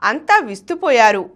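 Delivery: ordinary delivery, nothing marked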